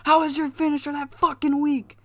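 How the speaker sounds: high, indignant yell at his partner; angry tag partner. Retold or imitated. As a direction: chew out your partner for a weak finisher.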